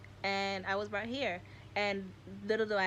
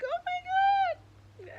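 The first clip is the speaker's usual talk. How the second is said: very high, loud and slow oh my God; overwhelmed cry; 7 dB louder, much slower than usual. Retold or imitated. A retold moment of hugging the tree, very high, slow overwhelmed cry. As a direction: wail it out, overcome. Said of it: "And I was like".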